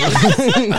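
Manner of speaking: high, loud laugh; burst of laughter. Real, not acted. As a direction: laugh out suddenly. Laughs at own noise.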